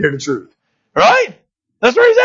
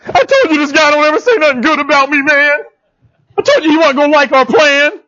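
a whiny complaint, pitched higher; whining; 8 semitones higher than usual. Retold or imitated. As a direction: whine like a sulking king. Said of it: "He's whining".

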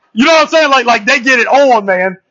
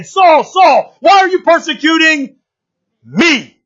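lightly voiced heavenly call, high then falling; 6 semitones higher, slower than usual. Preacher quotes heaven's voice to Saul, calling out. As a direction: call out, then pause before a dropped "me".

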